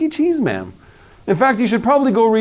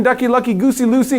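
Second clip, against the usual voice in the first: ordinary delivery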